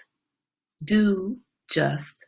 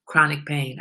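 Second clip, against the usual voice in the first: ordinary talk, nothing marked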